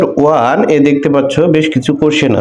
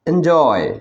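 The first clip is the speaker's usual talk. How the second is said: Enjoy with a drawn-out, falling জয়; careful model of enjoy, second syllable stressed; 4 dB quieter, much slower than usual. Shown as a demonstration. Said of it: "এটাকে উচ্চারণ করতে হবে এভাবে".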